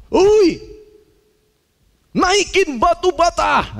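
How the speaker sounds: teriak tinggi dan keras ke bawah; teriakan memanggil. Retold or imitated. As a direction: panggil teman di lantai bawah. Tukang di lantai empat memanggil. (tukang di lantai atas memanggil temannya, berteriak keras)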